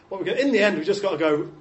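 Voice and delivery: ordinary talk, nothing marked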